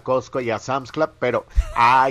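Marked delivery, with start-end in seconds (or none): none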